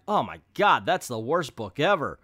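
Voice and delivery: loud, emphatic pan at ordinary pitch; hammed-up loud dismay; mock disgust. Reviewer imitating a reader who hated it, mock appalled. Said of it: "If you're like".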